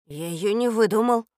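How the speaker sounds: ordinary delivery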